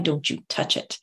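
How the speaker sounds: a quiet warning to keep off; firm boundary. Retold or imitated. Repeating the quoted boundary line.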